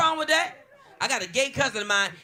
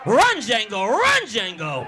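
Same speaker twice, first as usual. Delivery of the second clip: mock cheer; 2 semitones higher, 7 dB louder, much slower than usual. Mock urging a fleeing suspect.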